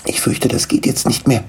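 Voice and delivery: hushed, breath-led confession; whispering. Acted. Niklas tells the gnome bad news, whispering. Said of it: "flüsterte Niklas".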